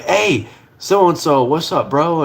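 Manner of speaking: slow, high-pitched "hey" called out; retold hailing greeting. Greeting a friend's stepdad on the porch, drawn-out hail.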